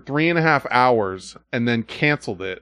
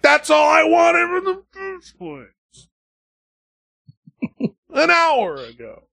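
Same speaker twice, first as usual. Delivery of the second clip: much higher, slow, shouted with a laugh; exasperated outburst; slower than usual. Retold or imitated. Fed-up customer to support agent, exasperated shout. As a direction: explode with relief and annoyance. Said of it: "and I'm like".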